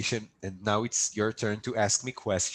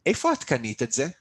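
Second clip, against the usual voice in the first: fast, words run together like real speech; quick everyday Hebrew, no pausing between words; natural native pace; 3 dB louder, much faster than usual. Shown as a demonstration. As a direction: rattle it off like a native speaker. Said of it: "as if it's an actual language".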